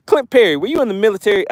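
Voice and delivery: usual speaking voice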